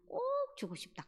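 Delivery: insisting. Retold or imitated. Elder insisting on giving money.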